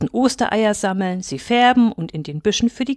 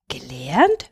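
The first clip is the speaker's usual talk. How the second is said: puzzled, sharply rising question; astonished; 7 semitones lower than usual. A narrator's reading. Little hare puzzled by the wolfhound's question, astonished. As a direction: echo the word with a rising lilt of surprise. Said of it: "fragte Purzel erstaunt".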